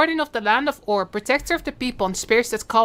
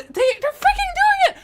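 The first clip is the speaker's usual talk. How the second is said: excited shriek. Real, not acted. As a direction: scream the date in pure joy. Overwhelmed by the announcement.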